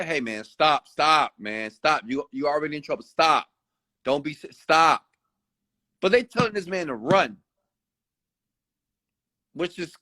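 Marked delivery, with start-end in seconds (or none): 0.0-5.0 higher, firmer urging to stop; mock firm plea. Telling the fleeing man to stop, a touch firm. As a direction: tell the runner to give up. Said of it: "to me it was say".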